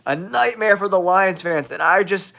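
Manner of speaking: ordinary talk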